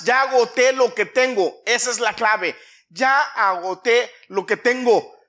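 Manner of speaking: loud, high, emphatic sermon delivery; full-force preaching. Real, not acted. Preacher explains the key to blessing, full force. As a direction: hammer each phrase out to the congregation.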